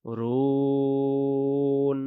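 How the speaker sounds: রুন held long with a still nun; held pause ending. Shown as a demonstration. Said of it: "একটা আমরা সাকিন দেব".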